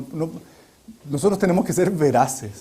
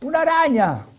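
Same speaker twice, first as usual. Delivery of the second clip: much higher, shrill mock cry for help; retold shriek; 10 semitones higher than usual. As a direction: imitate a frightened call. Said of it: "me gritaban".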